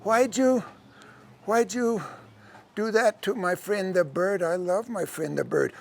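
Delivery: put-on very soft, halting child voice; upset little girl. Girl grieving her bird friend, soft and halting. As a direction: falter softly, as if near tears.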